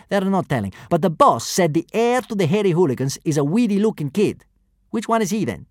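easygoing thug chat; kidnapper voice. A narrator's reading. First kidnapper talks Latin.

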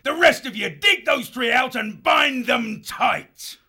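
high, loud commander's shout; shouted orders. Acted. Foxwolf chief to his horde, loud shouted orders. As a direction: bellow orders to the troops.

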